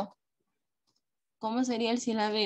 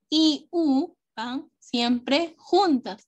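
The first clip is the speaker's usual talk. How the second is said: slow rule recital; 4 semitones higher, 4 dB louder, much slower than usual. Shown as a demonstration. Teacher stresses rule slowly.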